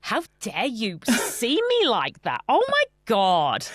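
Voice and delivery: slow, hammed-up offended gasp of a line; mock outrage. Joking at her co-host for spotting her PDA, mock outrage. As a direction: play scandalized, drawn out.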